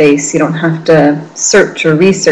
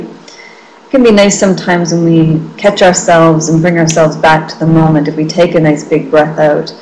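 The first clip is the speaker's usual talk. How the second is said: usual speaking voice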